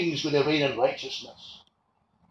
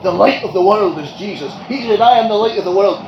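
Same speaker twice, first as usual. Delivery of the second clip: loud, ringing proclamation; emphatic preaching; 5 semitones higher, 10 dB louder than usual. Acted. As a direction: declare it to the whole hall. Preacher proclaiming Jesus' words.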